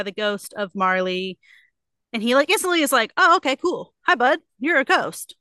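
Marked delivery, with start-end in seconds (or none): 3.2-5.4 higher, louder, breezy retold hello; mock chill greeting. Scrooge greets Marley's ghost, mock nonchalant. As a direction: play Scrooge absurdly casual.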